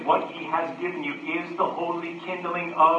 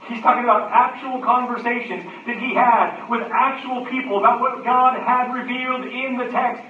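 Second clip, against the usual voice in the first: loud, raised preaching voice; full-force preaching; 7 semitones higher, 7 dB louder than usual. Acted. Preacher urges the connection on the congregation, full-force preaching.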